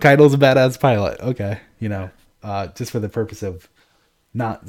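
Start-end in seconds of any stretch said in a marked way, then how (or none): 0.0-1.2 louder, higher, emphatic; excited correction. Real, not acted. Reader correcting a friend about the pilot, emphatic and excited. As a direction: set the name straight with gusto.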